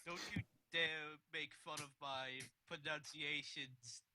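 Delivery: ordinary talk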